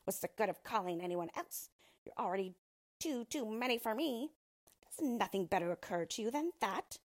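lightly voiced scornful dwarf; cranky dwarf. A narrator's reading. Dwarf to Rose Red about her offer of help, mildly scornful. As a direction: scold with a hint of dwarfish impatience.

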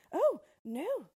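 high-pitched, disbelieving princess; storyteller's high princess in denial; protesting princess. Acted. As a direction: protest that it cannot be so. Princess denies the promise.

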